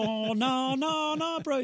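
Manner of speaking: high, drawn-out Maori English; pleading Maori bro voice; Maori accent. Acted. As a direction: protest in a put-on Maori accent. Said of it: "got my Maori accent down".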